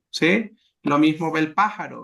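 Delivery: ordinary talk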